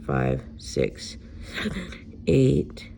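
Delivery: out of breath, words cut short; gasping between counted numbers; catching breath midword. Real, not acted. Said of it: "Sorry, lost my breath there for a second".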